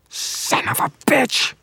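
high, strained, drawn-out curse; furious. A narrator's reading. Villain hearing he is betrayed, furious. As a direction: spit the curse out slowly in rage.

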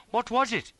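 high, loud startled question; alarmed cry. A narrator's reading. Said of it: "he cried".

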